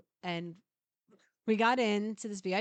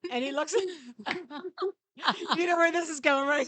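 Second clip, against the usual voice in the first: crying with laughter, raised; laughing; 6 semitones higher, 4 dB louder than usual. Real, not acted. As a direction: push the words through laughter. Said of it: "Yes. laughs".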